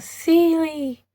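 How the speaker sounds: high ringing call of Celie's name. Retold or imitated. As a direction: cry out her name in recognition. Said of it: "Nettie's screaming out".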